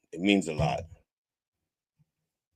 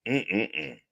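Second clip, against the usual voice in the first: usual speaking voice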